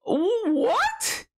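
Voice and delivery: sudden high squeak of disbelief; shocked what. Real, not acted. Player hearing a chat question, high shocked squeak. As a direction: yelp in disbelief.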